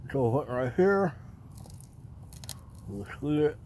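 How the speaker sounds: talking around a flashlight held in the teeth; words muffled by something in the mouth. Real, not acted. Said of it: "had a flashlight in my mouth".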